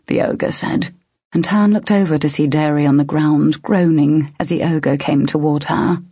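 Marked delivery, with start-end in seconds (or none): none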